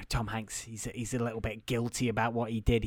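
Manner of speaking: usual speaking voice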